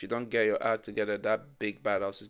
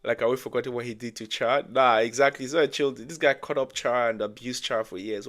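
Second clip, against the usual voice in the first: loud, worked-up objection; indignant rebuttal; 10 dB louder than usual. Real, not acted. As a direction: raise your voice in disbelief at the claim. Rebuts chill-dude claim, worked up.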